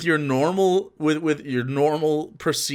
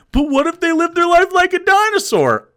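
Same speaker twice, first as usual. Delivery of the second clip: mock question; 12 semitones higher than usual. Mocking the opposing argument.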